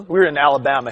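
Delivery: ordinary talk, nothing marked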